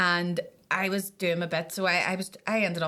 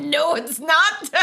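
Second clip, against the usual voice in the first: laughing mock denial; 7 semitones higher, 7 dB louder, much slower than usual. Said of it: "she went".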